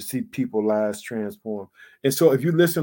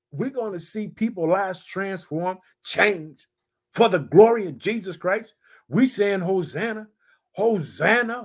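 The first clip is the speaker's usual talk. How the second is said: raised, ringing preacher's voice; preaching; 9 semitones higher, 3 dB louder than usual. Acted. Pastor exhorting his congregation, full preaching voice. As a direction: preach it out to the church.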